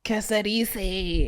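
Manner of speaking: put-on very slow, drawn-out Spanish accent; playful Spanish imitation. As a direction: stretch it out in a mock Spanish accent. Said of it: "'Cause I'm Spanish".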